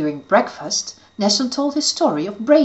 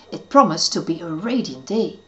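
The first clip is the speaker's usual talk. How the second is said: ordinary delivery, nothing marked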